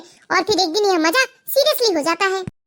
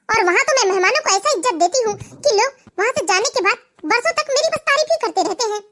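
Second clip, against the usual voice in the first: usual speaking voice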